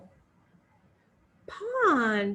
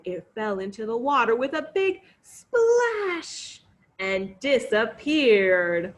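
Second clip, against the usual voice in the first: ordinary delivery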